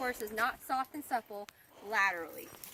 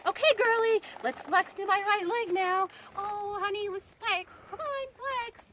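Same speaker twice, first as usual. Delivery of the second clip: high, sugary coaxing voice; sweet high baby talk; 9 semitones higher, 5 dB louder than usual. Shown as a demonstration. Rider cooing at her mare, high and sugary. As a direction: coo at the horse in a pitched-up voice. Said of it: "I can't just be like".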